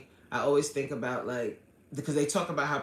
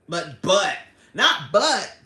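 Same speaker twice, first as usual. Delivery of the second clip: loud, punched protest; indignant objection; 3 semitones higher, 9 dB louder, slower than usual. Real, not acted. Host rejecting viewer's excuse about master's, indignant. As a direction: bat the excuse away loudly.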